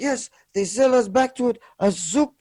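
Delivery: emphatic put-on Afghan accent. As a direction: insist on it in an Afghan driver's accent. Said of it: "I need to do the accent".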